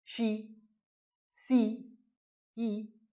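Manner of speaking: "शी, सी, ई" each alone with a long ee; long ee demo. Shown as a demonstration. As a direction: sound out "शी, सी, ई" word by word. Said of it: "इसमें ई sound है".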